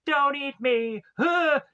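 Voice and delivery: mock panic. Boy begging father not eat.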